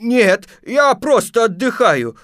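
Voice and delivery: high, loud, would-be merry voice; forced cheerfulness. Acted. Stuck Pooh denying trouble, with forced cheer. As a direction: sing out brightly, pretending all is fine. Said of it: "стараясь говорить весёлым голосом".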